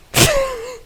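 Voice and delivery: high burst of laughter. Real, not acted. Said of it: "the most terrifying thing to come out of my mouth".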